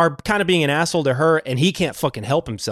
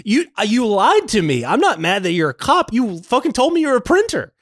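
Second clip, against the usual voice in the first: higher, indignant mock scolding; retold angry rebuke; 9 semitones higher than usual. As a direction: scold him like a lied-to lover. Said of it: "She's like".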